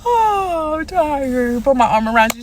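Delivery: hammed-up fake yawn with a high cry; mock yawn move. Mocking the date's move to get his arm round her, hammed-up yawn. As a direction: imitate the sly stretch-and-reach line. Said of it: "you know the".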